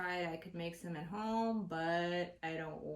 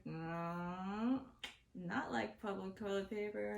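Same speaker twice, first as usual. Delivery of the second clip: retold firm denial; 4 dB quieter, slower than usual. Speaker setting the laughing friend straight, firm denial. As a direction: correct the friend in your own register. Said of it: "I'm like".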